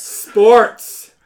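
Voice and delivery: very loud, high shouted command. Pretend. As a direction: yell it at the voice menu. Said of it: "Can you say that one more".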